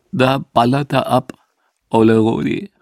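halting quote through mock tears; understated tearful voice, in short phrases; tear-choked. Max watching the crematorium smoke of his admired composer, tear-choked. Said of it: "sagte mit tränenerstickter Stimme".

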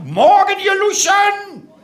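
high, drawn-out parade-ground shout; drill-instructor bellow. Acted. Drill instructor greeting his audience of recruits, bellowed. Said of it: "brüll die Leute an".

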